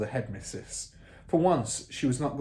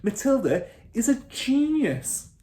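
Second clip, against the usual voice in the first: put-on high, earnest young teacher; teacher insisting her pupil is gifted; earnest declaration; 13 semitones higher, 7 dB louder than usual. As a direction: announce it with conviction. Miss Honey voiced.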